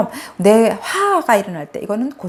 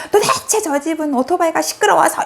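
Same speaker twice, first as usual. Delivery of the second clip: higher-pitched mock scolding of a neighbour; imitated grumbling complaint; 8 semitones higher than usual. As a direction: act out an elder's indignant griping, pitched up. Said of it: "막 야단을 하시는 거예요".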